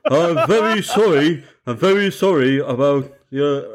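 put-on a touch of northern English accent; accidental northern. Contestant doing Roy, slipping into a mild northern accent. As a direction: apologise like a mild northern old man. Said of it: "I went northern somehow".

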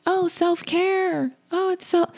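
very high, slow, dreamy mock gush; mocking gushing quote. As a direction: parody someone swooning over self-care.

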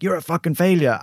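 high, harsh critic voice; mock the inner critic's sneer; harsh parent critic. Harsh critic voice berating herself in the ice bath. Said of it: "that old parent critic voice".

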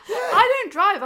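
blurted out, high and loud, through laughter; laughing. Real, not acted. Laughing at own ignorance.

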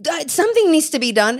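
high, pushy retort; irritated. Retold or imitated. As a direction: insist the chores can't wait. Arguing with husband over dishes.

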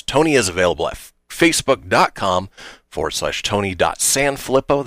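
ordinary talk

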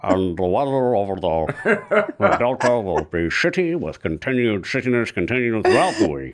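mock newscaster delivering the weather; Walter Cronkite. Host recalling Good Morning Vietnam, comic newscaster impersonation. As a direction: imitate a comedian's anchorman impersonation. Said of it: "Robin Williams' impersonation of Walter Cronkite".

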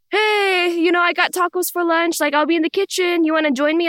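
high-pitched imitation of a casual dad; breezy, high lunch invitation; cheery dad. Imitates dad coming home cheerily.

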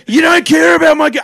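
high, loud indignant yell; hammed-up aggrieved shouting; mock angry beggar. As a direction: imitate a beggar picking a fight. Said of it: "then he started fighting".

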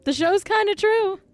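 high mock voice of the son; squeaky put-on defence of the show; high voiced imitation of a royal son; imitating Prince Harry. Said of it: "and he's like".

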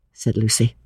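ordinary delivery, nothing marked

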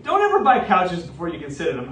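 ordinary talk

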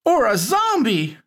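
put-on high, scared kid; trembling. A narrator's reading. Shawnee adds a zombie guess, scared high voice. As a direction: say it with a tremble of fear. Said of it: "Shawnee said, trembling".

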